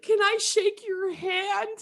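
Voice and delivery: very high, slow tearful squeal; hammed-up crying request, drawn out high; mock bawling. Bawling fan to her idol, mock-tearful squeak. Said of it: "I'm bawling".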